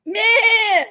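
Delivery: short high nasal goat bleat, loud; playful mock goat cry. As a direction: bleat like a little goat ringtone. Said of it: "my little goat ringtone in the background going".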